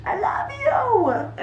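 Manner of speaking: high, slow coo to a pet; high drawn-out pet talk; doting coo. Real, not acted. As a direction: gush over the cat, stretching every word. Cooing at her cat.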